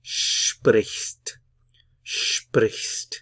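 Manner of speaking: sprichst with the ch sound then st; consonant cluster drill. Shown as a demonstration. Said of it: "follow it with the st".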